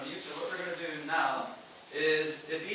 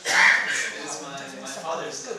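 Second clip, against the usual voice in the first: usual speaking voice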